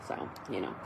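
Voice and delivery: ordinary talk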